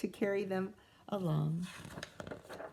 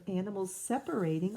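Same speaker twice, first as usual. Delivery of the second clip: ordinary delivery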